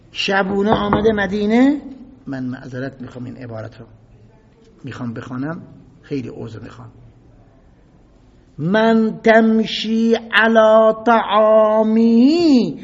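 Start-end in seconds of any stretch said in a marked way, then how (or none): 2.3-6.9 softer, lower apologetic aside; quiet, fast apologetic murmur; embarrassed apology. Real, not acted. Preacher apologises before crude quote. 8.6-12.8 very slow, loud and higher scornful chant; slow scornful Arabic recital; contemptuous recitation. Acted. Recites Uthman's insulting Arabic words.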